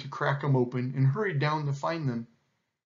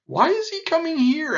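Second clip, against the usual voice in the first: high, loud hornbill outcry; alarmed question; 7 dB louder than usual. A narrator's reading. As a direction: demand an answer in a raised, high voice. Hornbill alarmed at lion's arrival.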